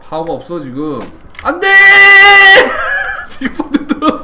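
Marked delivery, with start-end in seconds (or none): 1.4-4.2 loud laughing scream after falling; laughing shout. Real, not acted. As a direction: shriek with laughter, then wheeze. Falls in game, laughing shout. (웃음 섞인 비명)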